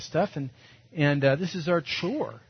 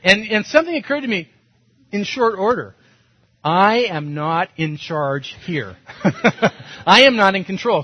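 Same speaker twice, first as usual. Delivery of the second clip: ordinary delivery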